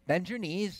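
put-on children urging a baby to jump; cheering siblings. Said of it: "And they say".

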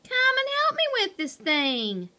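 high-pitched call for help. A narrator's reading. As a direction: call over to a teammate. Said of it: "called Chuckles".

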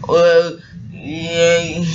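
long, loud drawn-out groan. Acted. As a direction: groan it out in two slow heaves. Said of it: "Samson groaned".

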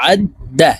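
আদ with the ain pressed from the middle of the throat; throaty ain sound. Shown as a demonstration. Teacher demonstrating আদ with a pressed throat ain, careful. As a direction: squeeze আদ from mid-throat, then the. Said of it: "আইনকে হলকের মধ্যখান থেকে চাপ দিয়ে উচ্চারণ".

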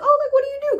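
high, bright mock question; high curious stranger. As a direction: play the eager stranger asking. LA people asking.

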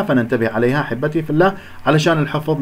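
ordinary delivery

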